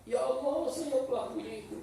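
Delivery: high, hushed lament; acted regret. A penitent sees another sin as he did, hushed regret. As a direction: breathe out a small, remorseful plea. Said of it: "dia yang menyesal".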